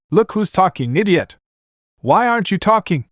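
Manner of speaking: high, fierce shouting back; angry shout. Acted. Naruto to Anna, angry shout. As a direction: throw the insult right back. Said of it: "Naruto shouted".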